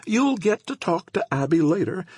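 put-on chatty elderly woman; old woman voice. A narrator's reading.

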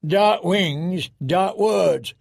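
muttered, but still voiced; Tormund. A narrator's reading. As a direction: grumble it as an ominous proverb. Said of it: "muttered Tormund".

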